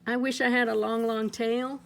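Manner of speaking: usual speaking voice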